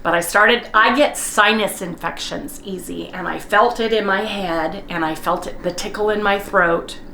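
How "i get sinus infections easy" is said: ordinary talk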